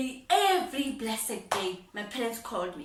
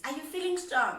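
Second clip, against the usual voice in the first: high, louder father voice; father's catchphrase; 7 semitones higher, 4 dB louder, faster than usual. Retold or imitated. Father's usual question to his daughter, high and louder. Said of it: "That's what he normally says".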